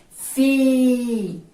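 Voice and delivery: "Fi" loud and held very long; loud, stretched middle syllable "Fi"; isolated syllable demo. Shown as a demonstration. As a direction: sustain "Fi" as one separate syllable. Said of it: "a palavrinha afinal que nós usamos aqui".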